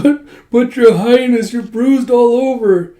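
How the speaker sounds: high, loud, slow mock wail; stammering, hammed-up weepy worry; tearful fussing. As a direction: wail it in over-the-top distress. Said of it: "You don't need to cry".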